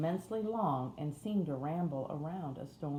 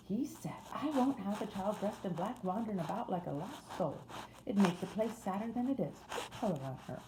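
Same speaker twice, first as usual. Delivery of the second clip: higher-voiced Yorkshire housemaid; broad Yorkshire accent; 4 semitones higher than usual. A narrator's reading. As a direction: wake them in broad Yorkshire, pitched up. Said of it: "in a broad Yorkshire accent".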